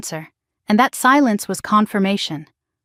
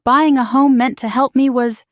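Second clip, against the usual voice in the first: ordinary delivery, nothing marked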